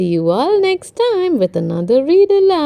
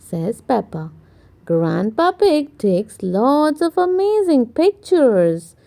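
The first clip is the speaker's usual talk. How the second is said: plain reading voice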